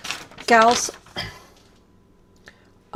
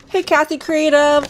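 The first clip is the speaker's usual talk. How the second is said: excited greeting; 10 semitones higher, 7 dB louder than usual. Real, not acted. Greeting a viewer by name.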